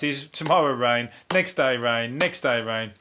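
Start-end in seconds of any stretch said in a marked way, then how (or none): none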